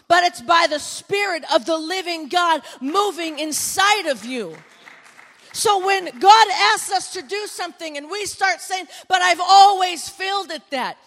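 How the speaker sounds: loud, driving pulpit delivery; full-force preaching. Acted. Preacher urging the congregation to obey, booming. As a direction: preach it out hard to the crowd.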